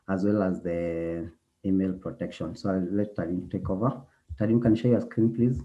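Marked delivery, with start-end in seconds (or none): none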